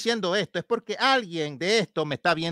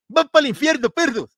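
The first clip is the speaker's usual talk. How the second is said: loud, high mock preacher yell; mocking street preacher; 8 semitones higher, 6 dB louder than usual. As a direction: ham up a ranting street preacher. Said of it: "Así es que predica".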